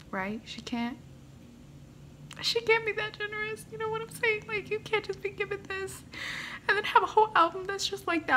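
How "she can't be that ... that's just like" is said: high, soft, overwhelmed voice; emotional. Real, not acted. Fan overwhelmed that a whole album exists, emotional. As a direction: squeeze it out softly, high with emotion. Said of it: "I'm sorry, I'm emotional".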